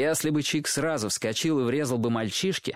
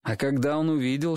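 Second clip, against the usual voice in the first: usual speaking voice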